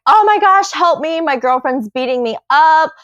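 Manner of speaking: mock distress call. Imitating girlfriend faking an attack to the dispatcher, mock distressed. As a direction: imitate a hammed-up victim, pitch falling as you go. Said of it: "She's making noises".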